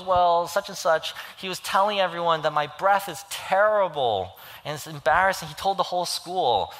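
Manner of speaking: high, loud retold child's complaint; upset little girl. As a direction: imitate an embarrassed kid pouring out a grievance. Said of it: "she's crying".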